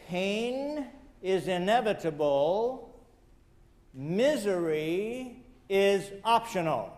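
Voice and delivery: slow, weighty, stress on "Pain" with a rising tune; slow and deliberate. Shown as a demonstration. Speaker quotes his answer to the fireman, slow and weighty. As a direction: let "Pain is inevitable" land one word at a time. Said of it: "write these words right under".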